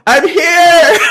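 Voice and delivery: high, loud laughing call; calling out from afar. Retold or imitated. Prue calling to her sisters from far away, loud and high. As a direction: shout it across the airport, laughing.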